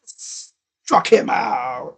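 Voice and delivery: low, very slow umpire-style punch-out call; deep, drawling celebratory call; drawn-out strikeout call. Acted. Calls a strikeout in play-by-play.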